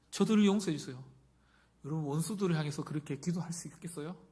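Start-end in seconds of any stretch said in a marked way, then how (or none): 0.1-1.0 loud, raised plea for forgiveness; fervent prayer. Acted. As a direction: cry out the prayer, high and strong. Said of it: "어떻게 기도하나요".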